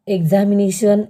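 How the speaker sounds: usual speaking voice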